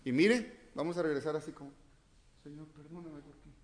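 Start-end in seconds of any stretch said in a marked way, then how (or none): 2.4-3.5 soft, pleading under the breath; hammed-up whimpering plea; mock hushed prayer. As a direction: pray in a tiny whisper. Praying believer voiced, hushed.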